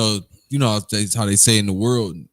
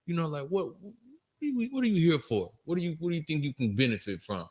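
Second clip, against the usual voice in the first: higher, softer voice of the questioning officer; imitating officer; 7 semitones higher, 8 dB quieter than usual. Retelling the officer asking why he came, softer and higher. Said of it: "he had asked me one time".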